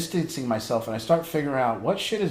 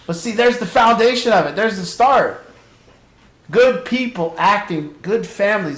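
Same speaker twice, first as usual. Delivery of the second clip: emphatic excited; 8 semitones higher, 8 dB louder than usual. Real, not acted. Emphatic point after laughter.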